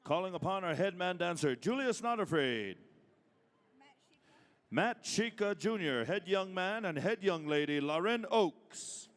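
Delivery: powwow emcee. Acted. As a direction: boom each name to the arena. Calling names at a powwow.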